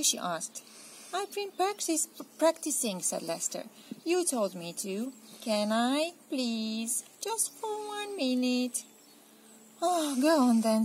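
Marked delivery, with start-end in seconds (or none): 1.1-8.8 put-on high wheedling kid; pleading child. Lester pleads with mum to play, high wheedling. 9.8-10.8 resigned sigh. Acted. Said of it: "sighed Missus Large".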